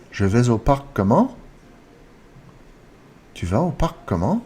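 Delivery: "comment" gliding up at the end; drilled questions rising on "comment"; rising intonation. Shown as a demonstration. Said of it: "It's a rising intonation".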